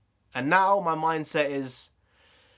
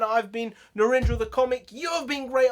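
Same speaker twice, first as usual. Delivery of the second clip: upbeat outro; 10 semitones higher, 3 dB louder than usual. Acted. High-energy vlog outro.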